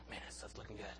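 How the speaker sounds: breathy hungry whisper, almost to himself; sigh, then whisper the craving under your breath; a drooling whisper over the pudding; hungry whispered aside. Retold or imitated.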